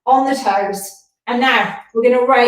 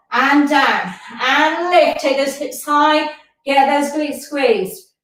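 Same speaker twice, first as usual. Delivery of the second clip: ordinary talk, nothing marked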